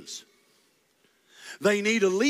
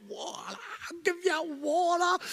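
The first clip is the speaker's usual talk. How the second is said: higher, strained outburst; exasperated Moses; 11 semitones higher, 4 dB quieter, slower than usual. Acted. Moses fed up with the people, mock outburst. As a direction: snap it like a leader at breaking point. Moses exploding, smashing rock.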